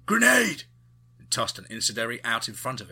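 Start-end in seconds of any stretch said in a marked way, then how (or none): 0.0-0.6 full yell, much higher and louder; shouted warning. A narrator's reading. Goodson warning the squad, full yell. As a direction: scream the warning. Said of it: "Goodson shouted".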